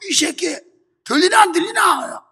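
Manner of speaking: high-pitched, angry Jeolla-dialect teacher. Retold or imitated. Gym teacher pressing a pupil again, angry Jeolla dialect. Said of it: "전라도 사투리로".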